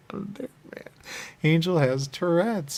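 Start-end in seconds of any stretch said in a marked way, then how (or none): 1.4-2.8 louder, slow and high; proclaiming Angel has Tourette's; loud slow declaration. Real, not acted. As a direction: announce it like a revelation.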